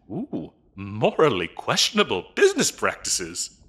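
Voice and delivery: normal talking voice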